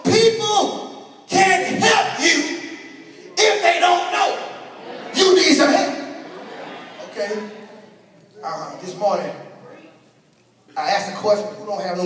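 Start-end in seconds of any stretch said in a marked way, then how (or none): 0.1-5.9 full-force preaching. Acted. Preacher driving the point home to the church in a raised preaching voice. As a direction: preach it, slowing down on help you.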